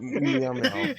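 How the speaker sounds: high squeaky meows; cat meow. Pretend. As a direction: squeak out two silly meows. Players joining in meowing.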